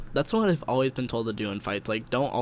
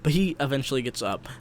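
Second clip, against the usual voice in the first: ordinary talk, nothing marked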